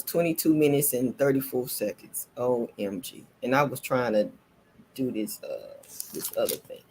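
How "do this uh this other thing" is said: soft unvoiced trailing aside; whisper. Real, not acted. Telling viewers about the other thing, whispered. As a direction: mutter it under the breath.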